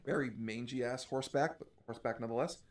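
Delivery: usual speaking voice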